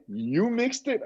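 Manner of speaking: high-pitched mock surprise; incredulous question. Listener learning who mixed it, acted incredulous. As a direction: imitate someone taken aback, pitch lifted high.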